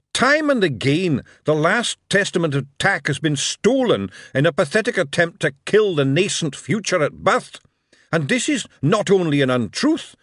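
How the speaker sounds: loud, high sermon voice; preaching. A narrator's reading. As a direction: preach to the crowd with fire. Bashfulson preaching to dwarfs.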